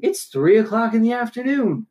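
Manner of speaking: very high exasperated protest; mock incredulous. Retelling incredulous reply to seatmate.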